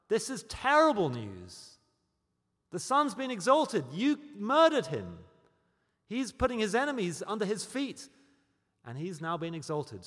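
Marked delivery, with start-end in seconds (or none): 0.1-8.1 loud, higher, slowed accusing words; preaching at full force. Acted. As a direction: hammer home the guilt. Preacher drives home guilt.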